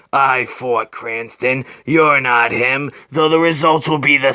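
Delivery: low, slow, menacing growl of a killer; menacing villain Stag. Acted. Stag threatens Batman, low and menacing. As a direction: loom over him, slow and low.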